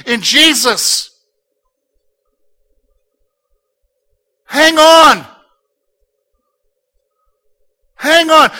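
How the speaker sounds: loud, rising pulpit exhortation; rally the congregation not to quit; fiery sermon climax, slowing on key words; full-force preaching. Acted.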